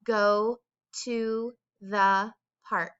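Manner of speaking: slow, clear sentence dictation; dictation read. Shown as a demonstration. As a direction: read it slowly for writing. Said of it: "the sentence is".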